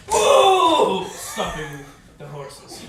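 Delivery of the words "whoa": loud, long startled yell; shouting whoa. Acted. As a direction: haul on the reins and yell. NPC driver reins in the horses.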